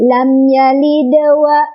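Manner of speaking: slow chanted "lam yalid wa", d lightly bounced; small bounce. Shown as a demonstration. Teacher shows mid-reading qalqalah, light bounced "yalid". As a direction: give the d of "yalid" a brief echo into "wa". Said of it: "pantulannya kecil aja".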